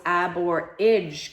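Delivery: "ab or idge" in slow, separate beats; slowly stretched syllables in "ab or idge"; syllable split. Shown as a demonstration. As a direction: sound out "ab or idge" piece by piece. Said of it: "here's my vowel sound in this one".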